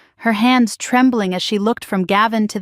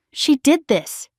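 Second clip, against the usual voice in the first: higher, forced roar; 5 semitones higher, slower than usual. A narrator's reading. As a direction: roar the accusation. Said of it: "he roared".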